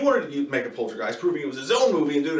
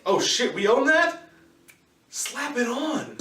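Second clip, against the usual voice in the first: high, mocking greedy-studio voice, slow on the last line; mock studio exec; 8 semitones higher, slower than usual. Reviewer mocking the studio that owns the film, high and drawn out. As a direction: play the lazy studio boss, stretching out slap it on.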